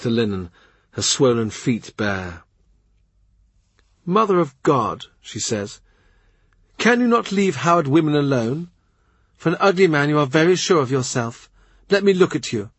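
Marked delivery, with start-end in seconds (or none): 4.0-5.1 lightly higher, sharp queenly impatience; a pregnant queen fed up with visitors; hint of an irritable queen; exasperated queen. Anne voiced in story. 6.8-12.7 lightly higher, sharp queenly impatience; a pregnant queen fed up with visitors; hint of an irritable queen; exasperated queen. Anne voiced in story.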